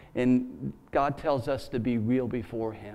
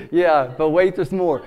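loud, higher hammed-up TV ad voice; big booming but wait there's more; infomercial pitchman; 3 semitones higher, 10 dB louder than usual. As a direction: shout it like a late-night infomercial host.

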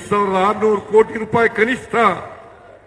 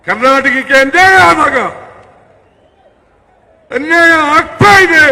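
indignant shouted protest; rally shout; 7 semitones higher, 9 dB louder than usual. Real, not acted. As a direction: hammer out the injustice to the crowd. Rally speech, injustice to Karnataka.

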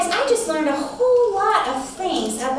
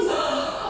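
higher, softer gasp; 12 semitones higher, 4 dB quieter than usual. Acted. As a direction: gasp in playful wonder. Introducing big spider.

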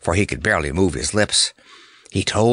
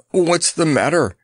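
choked; 7 semitones higher than usual. A narrator's reading. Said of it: "choked Notta".

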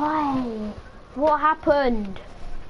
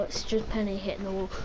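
ordinary talk

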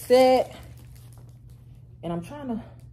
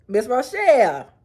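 Miss Rochelle lingo; 4 semitones higher, 6 dB louder than usual. Retold or imitated. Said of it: "Miss Rochelle lingo".

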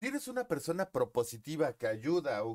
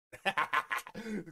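real laugh; 6 semitones higher than usual. Real, not acted. Joking about skin color.

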